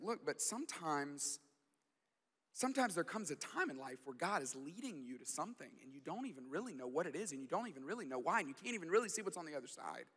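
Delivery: soft, tender explanation to his daughter; dad reassuring crying daughter; gentle reassurance. Retold or imitated. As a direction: comfort her quietly.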